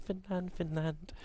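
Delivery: soft echo trailing off; fading echo. Pretend. Caster echoing the team name for the audience, fading echo.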